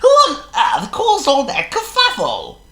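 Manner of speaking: a chirpy, fussy bird; high loud blackbird voice; Charles the Blackbird. A narrator's reading.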